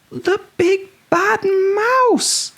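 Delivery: very high slow drawn-out scream; high slow fearful cry; terrified yell. A narrator's reading. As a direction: stretch it in terror. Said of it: "yelled the Gruffalo's child".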